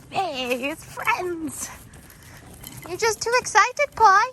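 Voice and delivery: overexcited puppy bursting with joy; excited dog voice. Retold or imitated. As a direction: owner voicing her excitable dog meeting a favourite boy, high and loud. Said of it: "As Pumpkin's like".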